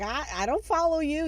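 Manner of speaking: hammed-up protest, voice jumping up; indignant protest. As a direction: stammer it out in mock outrage. Michael protests to the demon.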